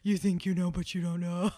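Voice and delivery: silly teasing character voice; playful put-on voice. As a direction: put on a mock-knowing voice to tease. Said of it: "never do that voice again".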